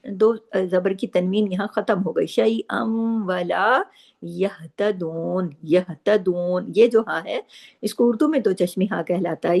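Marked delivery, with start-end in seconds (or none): 2.3-6.7 यहतदून with the हा breathed from the chest, not the throat; slow recitation. Shown as a demonstration. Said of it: "इसकी आवाज़ सीने की तरफ जाती है".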